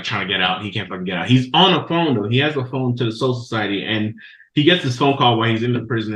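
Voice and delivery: usual speaking voice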